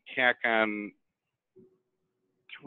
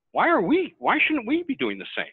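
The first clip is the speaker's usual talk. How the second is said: emphatic appeal; 10 semitones higher, 3 dB louder than usual. Real, not acted. Rhetorical appeal, rising emphasis.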